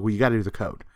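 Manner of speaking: ordinary talk, nothing marked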